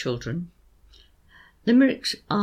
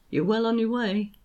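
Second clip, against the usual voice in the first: ordinary talk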